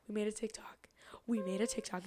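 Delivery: hushed, voiceless repetition; awed whisper. Real, not acted. Fan confiding her big moment, whispering. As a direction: whisper it like unbelievable news.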